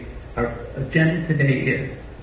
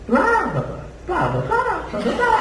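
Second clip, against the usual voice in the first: blahs climbing very high; exaggerated high voice. Shown as a demonstration. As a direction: push each set of blahs higher into a squeal. Exaggerated demo after "can't pronounce it.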